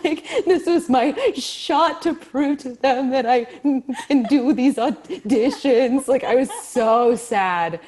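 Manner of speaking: real laughter breaking through a sad memory; laughing through it. Real, not acted. Recalling her failed audition day, laughing while talking.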